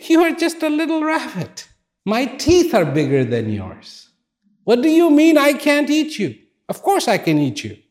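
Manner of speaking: lion sneering at the tiny rabbit, hammed-up; mocking lion. Lion mocks the little rabbit.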